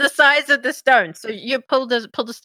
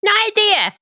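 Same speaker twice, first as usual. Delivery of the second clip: higher, eager little servant; imp voice; 11 semitones higher, 3 dB louder than usual. Acted. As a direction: play a bound imp, brightly.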